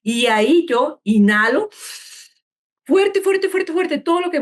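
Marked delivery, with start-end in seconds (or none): 2.8-4.4 high, tightened voice, words in a quick chain; forceful inhale demo. Shown as a demonstration. Coach showing parents how hard to breathe in, high and urgent. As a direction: pitch jumps up as if filling the lungs.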